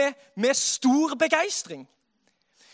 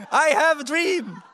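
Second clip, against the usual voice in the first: louder, slow, grand orator; louder slow I have a dream in English; Martin Luther King impression; 6 dB louder, slower than usual. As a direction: boom it like a civil rights speech. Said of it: "jeg har en drøm".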